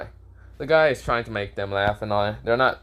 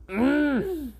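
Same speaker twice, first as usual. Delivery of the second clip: squeaky annoyed mm; a tight high grunt of frustration; high frustrated hum. Acted. Hanabi reacts as Boruto escapes.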